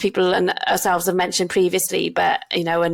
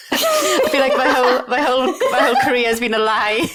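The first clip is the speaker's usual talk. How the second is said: higher-pitched, laughing confession; 5 semitones higher, 3 dB louder than usual. Real, not acted. Doctor confessing disillusion to co-host, laughing. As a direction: say it through rising laughter.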